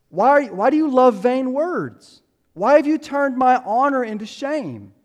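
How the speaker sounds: loud, high-pitched angry demand; acting out David ripping into his accusers. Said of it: "and he's angry".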